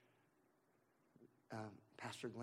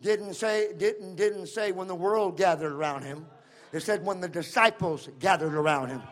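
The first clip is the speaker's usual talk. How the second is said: loud, high, driving preacher's cry; full-force preaching; 5 semitones higher, 18 dB louder than usual. Acted. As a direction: preach it to the rafters, building the room up.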